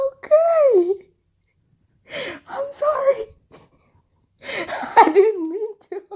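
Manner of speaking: very high squeaky apology through giggles; laughing apology. Retold or imitated. Apology retold while cracking up.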